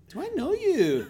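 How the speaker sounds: very high, loud Scottish lady; piercing mock Scottish question. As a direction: imitate a Scottish woman calling out, astonished. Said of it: "there was a Scottish lady there".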